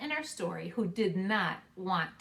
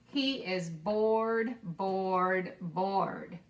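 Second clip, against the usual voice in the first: drawn out boredom; 2 semitones lower, much slower than usual. Acted. Repeated word in story.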